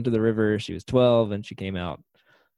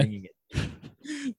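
mock grand thanks; 12 semitones higher, 8 dB quieter, much slower than usual.

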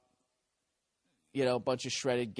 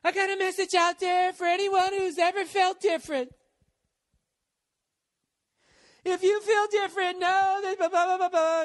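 very high, loud, breathy-free diva preaching; 8 dB louder than usual. Retold or imitated. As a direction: ham up a self-help speech to fans. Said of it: "she's just like".

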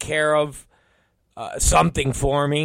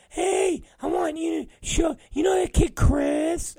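high, eager falsetto impression; mock him pitching a dumb idea in a squeaky voice; Brandon; 14 semitones higher than usual. Coworker pitching a wrestler to his boss, high mocking falsetto. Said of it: "Brandon has a lot of stupid".

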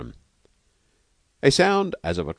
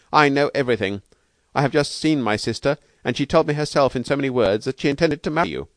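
tart, higher-pitched insistence; 8 semitones higher, 4 dB louder than usual. A narrator's reading. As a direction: confront him with what you know. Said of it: "he said tartly".

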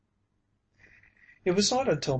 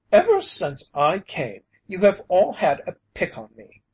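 glaring accusation; 6 semitones higher, 7 dB louder than usual. A narrator's reading. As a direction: hurl the blame at all of them. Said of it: "he glared at them".

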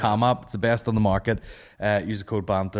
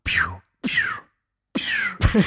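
mock laser sound; 13 semitones higher, much slower than usual. Sound effect for echo.

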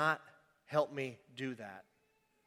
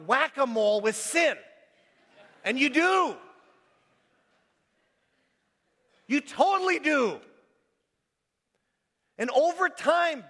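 high, loud, fast-driving sermon voice, hammering each line home; full-force preaching; 10 semitones higher, 8 dB louder than usual. Acted.

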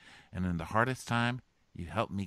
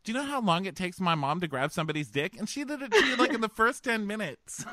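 hammed-up hurt girl; 8 semitones higher, 7 dB louder, faster than usual. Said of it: "She's like".

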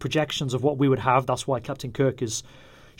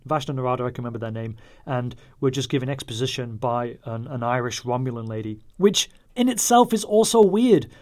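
ordinary delivery

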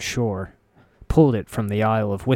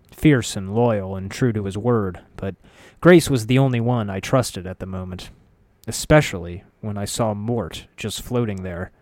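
plain reading voice

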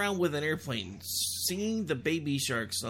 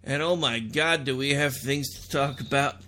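radio host intro; 4 semitones higher, 8 dB louder than usual. Acted. Radio show opening.

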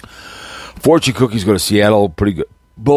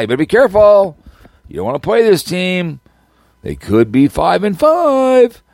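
high teasing caution, slowing at the end; mock warning; 9 semitones higher than usual. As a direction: warn them off in a high, hammed-up voice. Mock warning about Denver.

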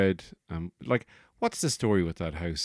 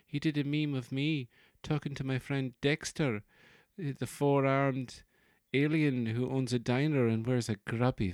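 higher-pitched chatty actor voice; Ewan McGregor; 5 semitones higher than usual. Acted. Actor recounts a co-star's meme, high impression. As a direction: gossip in the put-on high voice. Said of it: "So Ewan McGregor was there".

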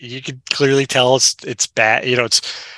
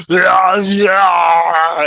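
loud, high mock yell of "yeah, yeah"; hammed-up Arnold battle cry; imitating Arnold's battle yell; 6 semitones higher, 6 dB louder than usual. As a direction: bark it out like a dazed action hero. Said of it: "he's just like".

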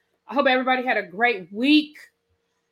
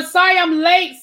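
playful sorry; 7 semitones higher, 9 dB louder than usual. Acted. Greeting chat late, playful apology.

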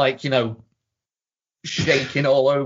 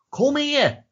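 high-pitched acted call; imitating a call through a door; mock summons. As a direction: call someone over in a raised pitch. Acted exchange through a shut door.

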